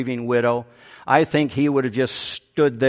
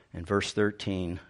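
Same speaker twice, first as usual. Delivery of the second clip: ordinary talk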